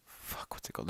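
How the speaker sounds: whispered, forgetting a name; whispered aside. Real, not acted. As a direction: whisper it to yourself.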